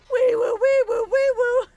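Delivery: very high, loud siren wee woo. Pretend. Streamer doing a meme sound for chat, loud siren wail. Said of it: "You know that one?".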